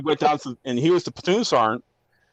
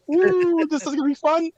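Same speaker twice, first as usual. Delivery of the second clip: high-pitched gleeful "ooh", much higher than usual. Retold or imitated. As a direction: sing it out with gleeful malice, voice way up. Gleeful at confronting the sergeant.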